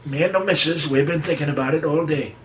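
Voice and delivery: put-on high, old Yankee farmer voice; Titus Moody. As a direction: chew it like an old New England farmer. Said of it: "he'd talk like that".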